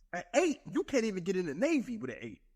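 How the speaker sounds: ordinary delivery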